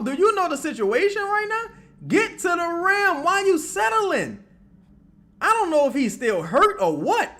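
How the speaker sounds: high, loud exasperated shouts; frustrated yelling. Real, not acted. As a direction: plead angrily for him to drive. Yelling at Poole on TV.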